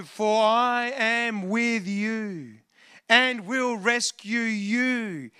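very drawn-out, deliberate promise; slow proclamation. Retold or imitated. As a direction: stretch each word as God's solemn assurance. Proclaims God's promise from scripture.

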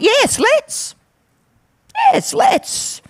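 high, eager yes let's, second one hushed; Trinity agreeing. Acted. Pastor voicing the Trinity agreeing, high and eager. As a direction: cheer it high, then breathe it. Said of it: "the Trinity came into agreement and went".

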